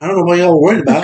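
laughing mock nonchalance; nonchalant guy. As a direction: toss it off like it's nothing. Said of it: "the guy's just like".

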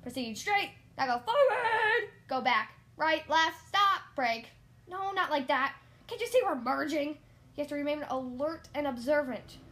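very loud, bossy driving instructor; exasperated yelling from the passenger seat; shouted orders. A narrator's reading. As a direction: bellow corrections at the learner. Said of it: "spinning from all of his orders".

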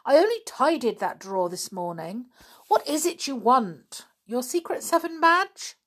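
exasperated telling-off; cross. A narrator's reading. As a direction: voice a cross mum, pitch lifted. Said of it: "said her mummy crossly".

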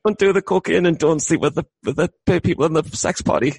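high, giggly delivery; amused. Real, not acted. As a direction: crack up at your own joke while talking. Laughing through his own joke.

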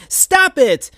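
high, loud cry; cartoon hero shout. A narrator's reading. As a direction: yell at him to quit. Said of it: "shouted Sonic".

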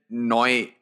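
"noi" with an oy sound for now; imitated Northern Irish "noi", drawn out; Northern Irish vowel. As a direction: say "noi", rhyming with boy. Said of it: "they pronounce it as noi".

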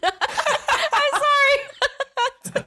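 high, slow laughing through words; can barely get it out laughing; laughing apology. Real, not acted. Laughing fit while apologizing.

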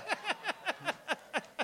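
high burst of real laughter. Real, not acted. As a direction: laugh at your own bad luck. Laughing at own situation.

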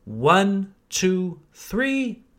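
ordinary talk, nothing marked

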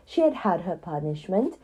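usual speaking voice

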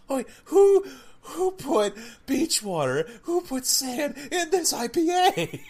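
high, loud hammed-up outrage; mock outrage. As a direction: squeal at the sandy beer. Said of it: "It's like".

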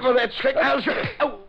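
high, alarmed yelps mid-struggle; panicked cry. Acted. Frenchie crying out while the Hornet overpowers him. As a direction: blurt it out as you lose the fight.

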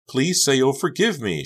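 high, loud pleading; begging. A narrator's reading. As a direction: beg her for forgiveness. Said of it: "she begged".

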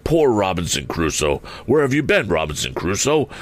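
ordinary delivery

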